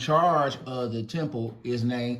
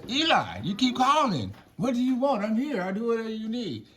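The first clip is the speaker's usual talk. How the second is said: put-on higher, eager kid's voice; eager boy; 9 semitones higher than usual. Young Samuel eagerly asking Eli what he needs. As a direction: sound like a keen young boy reporting for duty.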